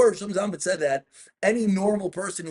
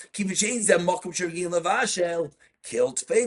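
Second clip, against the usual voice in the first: ordinary talk, nothing marked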